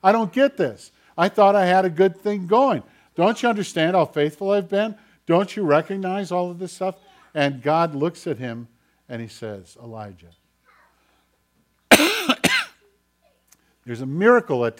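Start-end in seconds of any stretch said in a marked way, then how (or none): 0.0-7.0 acted bewildered complaint, high and loud; imitating an exasperated prophet's appeal; Elijah's frustrated protest. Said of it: "That was the dilemma for Elijah". 9.8-10.3 low, soft single name; imitated calm divine voice, much softer; quiet gentle call. God's quiet reply to protest.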